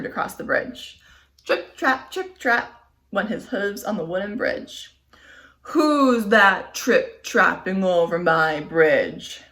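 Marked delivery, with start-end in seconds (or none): 5.6-9.4 loud, slow troll bellow; troll challenging the biggest goat, bellowing. Acted. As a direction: bellow it from under the bridge. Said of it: "bellowed the troll".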